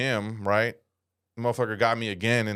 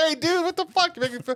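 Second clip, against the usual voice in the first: much higher indignant voice, imitating himself; high mock outrage. Mocking his teen self protesting to George, high voice. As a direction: play your younger self complaining. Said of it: "I was like".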